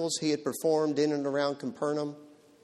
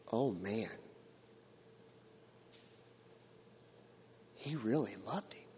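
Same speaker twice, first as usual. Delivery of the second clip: moved onlookers; 4 semitones lower, 13 dB quieter than usual. Acted. Onlookers moved by Jesus's tears.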